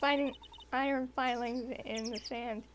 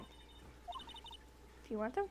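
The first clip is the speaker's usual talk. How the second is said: whispering; 8 semitones higher, 11 dB quieter, much slower than usual. Acted. Creepy whispered offer.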